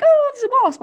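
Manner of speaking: put-on high, loud shrieking squeamish girl. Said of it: "squeamish girls".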